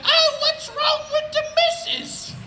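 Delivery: very high-pitched British put-on voice; annoying falsetto British character; silly British voice. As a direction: pester your wife in a squeaky British voice. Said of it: "one of my voices I do".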